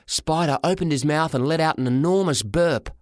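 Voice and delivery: ordinary delivery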